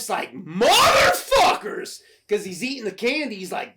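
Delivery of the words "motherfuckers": high, loud, drawn-out howl; hammed-up villain curse, slowly stretched; mock outraged cry. Voicing Stripe as sole surviving gremlin, outraged mock howl.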